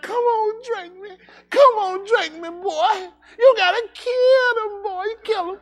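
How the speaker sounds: high, slow, whiny voice of a sweaty cup; high crying plea of a cola cup egging him on; talking Coke cup. Pretend. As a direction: tempt him like a needy soda.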